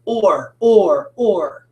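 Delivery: "or" as one drawn-out sound, repeated; r-controlled vowel. Shown as a demonstration. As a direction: say "or" slowly, O and R blended together. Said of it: "in my head goes".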